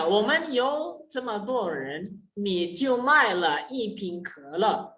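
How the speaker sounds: slow, careful example sentence; slow demo reading. Shown as a demonstration. Teacher reading an example sentence to students, slow and careful. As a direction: read each syllable out slowly for the class. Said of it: "Berbeda hanya pada penekanan".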